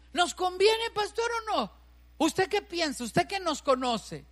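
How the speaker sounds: loud, high, insistent voicing of a couple asking the pastor; loud pleading questions. Couple pleading with their pastor, loud and insistent.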